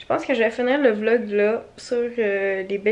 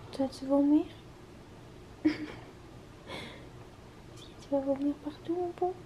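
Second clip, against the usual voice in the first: much softer, near-whispered sympathy; hushed pity; 13 dB quieter than usual. Real, not acted. Owner hushing over her drunk dog, soft pitying voice. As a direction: murmur it to the sick pup.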